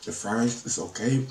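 words around food; talking while chewing. Real, not acted. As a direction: mouth partly full. Seen 1: Fork to mouth just before; jaw looks mid-chew while speaking.

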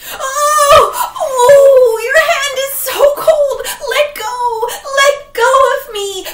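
high loud panicked yelling; screaming old man struggling; terrified shout. Acted. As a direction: shriek as the cold hand grabs. Ghost grabs leg.

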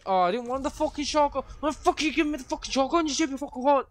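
high, loud furious rant. Real, not acted. Streamer raging at the game over the shotgun, loud fast rant.

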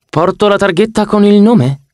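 high, amazed question; genuinely baffled he was recognised; vain surprise. Acted. Ganymede asking Annabeth how she knew, high and amazed.

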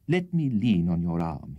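usual speaking voice

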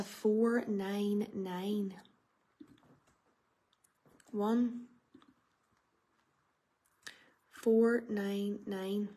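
very slow number call; bingo caller. Real, not acted. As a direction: stretch every number out. Calling the number range.

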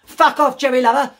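high, loud furious yell; shouting. Acted. Young girl shouting at the stranger in the shop: loud furious yell. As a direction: scream it in his face. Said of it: "and shouted at me".

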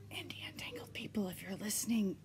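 soft whispered aside; playful whisper. Pretend. Podcaster secretly appealing to show organizers, playful whisper.

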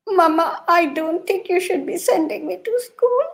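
put-on a touch of a tearful little girl; mildly sad plea; sad child. Little girl back from school to her mother, mildly tearful. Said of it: "with a really sad face".

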